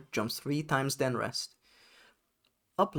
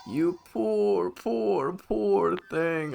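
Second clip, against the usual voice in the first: higher, cooing pitying spirit; sweet fairy voice; 5 semitones higher than usual. Acted. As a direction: coo over them like a gentle fairy. Sweet star spirit character.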